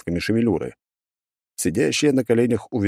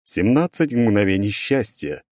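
stone monotone golem; 4 semitones higher than usual. Acted. Golem lists damages.

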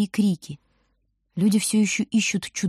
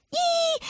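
a piercing high shriek; wordless terrified squeal; shrill scream; 3 dB quieter than usual. A narrator's reading. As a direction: scream out high in sheer fright. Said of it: "визжит она".

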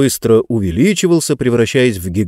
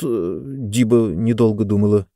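plain reading voice

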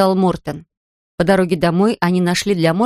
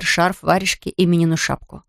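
ordinary delivery

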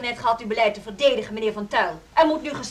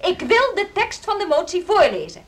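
heated insistence; 6 semitones higher than usual. Acted. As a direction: push back with rising heat. Insists during a heated meeting.

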